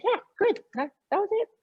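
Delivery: high, light voice of approval; reassuring listener. Acted. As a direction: imitate someone giving quick reassurance. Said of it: "looking for somebody to say".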